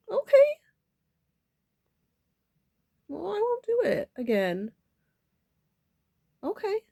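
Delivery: high, loud tearful reply, acted out; tearful giving-in. Speaker answering the one who scolded, tearful promise.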